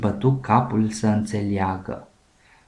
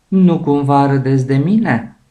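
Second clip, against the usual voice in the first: hurt, annoyed question, pushed out louder; upset bear; 4 semitones higher, 7 dB louder than usual. A narrator's reading. Bear confronting the laughing seals, upset and louder. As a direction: demand it like an offended bear. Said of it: "întrebă el supărat".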